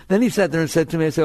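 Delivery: normal talking voice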